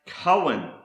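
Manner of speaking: Cohen said KOH-en; Hebrew pronunciation. Shown as a demonstration. Teacher introducing a Hebrew word, Cohen pronounced KOH-en. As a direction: say "Cohen" as KOH-en, set apart. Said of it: "is pronounced".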